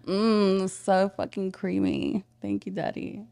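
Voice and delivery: slow, breathy, drawn-out praise; sultry moan. Shown as a demonstration. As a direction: purr it slowly. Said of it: "get in full character".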